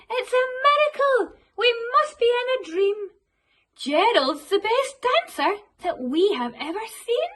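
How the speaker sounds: high, loud astonished cheering; amazed shouts. Acted. Animals cheering Gerald's dancing, loud amazed shout. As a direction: cheer as if you can't believe it. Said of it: "They shouted".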